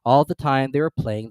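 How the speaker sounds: ordinary delivery, nothing marked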